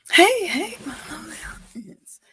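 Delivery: ordinary delivery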